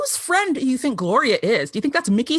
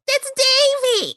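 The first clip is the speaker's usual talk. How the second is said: high, slow put-on Gloria voice; hammed-up Gloria; much slower than usual. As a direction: stretch it out, dim and sure. Acting Gloria's confusion.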